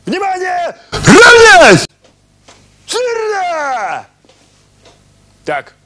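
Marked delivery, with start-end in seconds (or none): 0.0-4.1 barking drill commands at full volume; shouting drill sergeant. Acted. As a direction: yell orders like an army sergeant.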